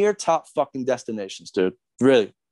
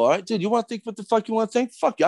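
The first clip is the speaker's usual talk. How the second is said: fast, higher-pitched angry outburst; heated retort; 7 semitones higher than usual. Real, not acted. Fed up with Clyde, firing back a fast heated retort. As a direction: spit it out fast and dismissive.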